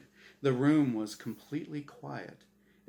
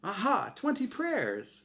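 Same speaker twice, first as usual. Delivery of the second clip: triumphant; 10 semitones higher than usual. Acted. Azrael scoring triumphantly.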